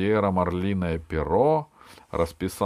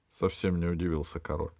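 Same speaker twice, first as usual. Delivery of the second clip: usual speaking voice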